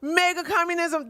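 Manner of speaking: mocking disbelief. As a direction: echo the phrase as if it were absurd. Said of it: "That's why I'm like".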